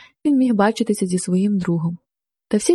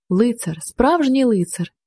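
usual speaking voice

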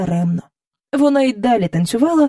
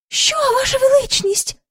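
much higher alarmed cry; startled courtiers. Acted. Courtiers cry out to the king, startled and high. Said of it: "скрикнули придворні".

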